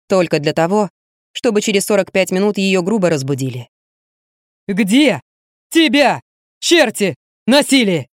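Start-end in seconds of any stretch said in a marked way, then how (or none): 4.7-8.1 high, slow, angry demand; furious demand. Acted. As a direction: shake the answer out of her. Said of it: "яростным встряхиванием".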